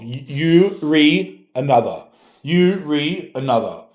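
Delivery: "you re another" said slow, each word apart; mocking "you re", drawn out slowly; misspelling read out. Shown as a demonstration. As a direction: spell out "you re" as two words. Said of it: "you forgot some punctuation".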